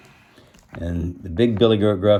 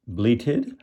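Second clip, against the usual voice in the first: ordinary delivery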